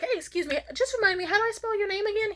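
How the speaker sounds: high, put-on polite voice; polite ask. Asking a man at open mic, high and polite. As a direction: pitch it way up and ask innocently.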